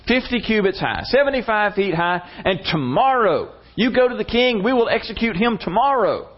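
high, insistent scheming; urgent egging-on. Retold or imitated. Wife urging Haman to build the gallows, urgent.

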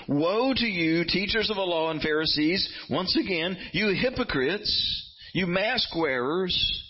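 high, slow prophetic rebuke; preaching at full force. Retold or imitated. Preacher voicing Jesus's woes to Pharisees, slow high rebuke. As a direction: condemn them word by word. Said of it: "he goes on to say in verses".

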